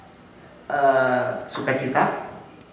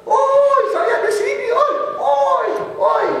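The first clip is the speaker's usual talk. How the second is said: high shouted calls, with a laugh; calling out lost; 3 dB louder, slower than usual. Retold or imitated. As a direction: yell like someone lost in a crowd. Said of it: "Pertama yang kita ngomong apa?". (teriak tinggi seperti orang tersesat)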